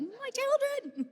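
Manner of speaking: high, slow, hammed-up wail; a mother mock-wailing for her children left behind by the bus.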